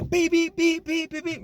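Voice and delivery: high loud beep-beep horn noise; imitated car horn. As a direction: mimic a van horn honking. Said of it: "they love beeping their horns".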